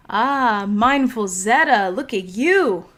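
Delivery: loud, high, delighted welcome with swooping pitch; excited greeting. Real, not acted. Livestream host spots regulars in the chat, excited greeting. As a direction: call out happily to arriving friends.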